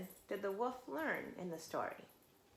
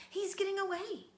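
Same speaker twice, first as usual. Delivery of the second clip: urgent; 10 semitones higher, 3 dB louder than usual. A narrator's reading. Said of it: "the Little Wolf begged".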